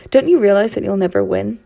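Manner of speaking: loud, taunting jeer. Acted. Archie taunting the beaten narrator, loud and scornful. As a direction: rub the defeat in her face.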